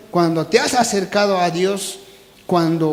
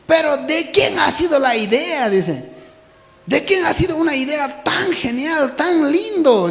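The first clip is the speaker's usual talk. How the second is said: higher, eager questions; excited wondering; 8 semitones higher, 3 dB louder than usual. Acted. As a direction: ask in breathless wonder. Animals ask whose idea. (preguntas asombradas y agudas)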